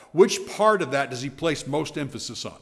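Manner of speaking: ordinary delivery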